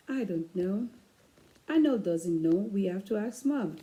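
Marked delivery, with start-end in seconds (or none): none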